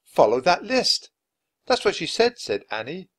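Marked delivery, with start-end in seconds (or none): 0.0-1.1 Jack repeats the words slowly, thinking; slowed, puzzled repetition; drawn-out musing on the words; slow, pondering. A narrator's reading. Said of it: "said Jack". 1.7-2.4 put-on much higher girlish voice; bright, raised voice for the young girl; girlish reply, higher; Annie. A narrator's reading.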